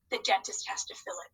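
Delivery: hushed unvoiced aside; whisper. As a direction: drop into a secret whisper. Teacher aside to kids.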